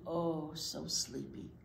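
ordinary talk, nothing marked

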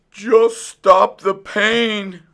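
high, loud, slow whimper. A narrator's reading. Fox in the dentist's chair, whimpering loudly. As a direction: drag it out, pleading in pain. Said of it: "whimpered the fox".

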